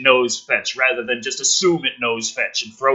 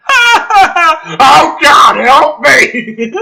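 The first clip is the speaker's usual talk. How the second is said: much higher, loud mock plea; hammed-up cry for help; 10 dB louder, much slower than usual. Comedian mocking a scared soldier, hammed-up shriek. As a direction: shriek for help like a cartoon victim.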